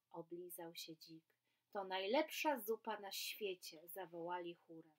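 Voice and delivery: lively storybook reading with voiced animals; reading to children. A narrator's reading. Teacher reading a story aloud to children, lively and animated. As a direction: read it like a bedtime tale for kids.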